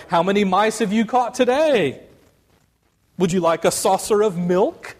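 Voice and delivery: jeering. Acted. The rabble taunts Aslan, lightly voiced high jeer. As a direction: tease him like a cat. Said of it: "jeering at him".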